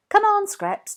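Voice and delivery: high, loud call; calling the dog. A narrator's reading. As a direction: call out across the cave.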